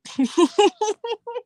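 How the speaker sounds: high squealing laugh; big laugh. Real, not acted. Laughing at assassin joke.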